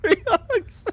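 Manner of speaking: squeaky laugh-choked free hugs; forced out through helpless laughter. Real, not acted. Trying to heal a teammate while laughing. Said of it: "I'm literally crying, laughing".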